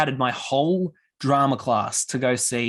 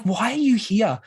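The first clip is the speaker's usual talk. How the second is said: exasperated; 9 semitones higher than usual. Retold or imitated. Angry retort at rowdy kids.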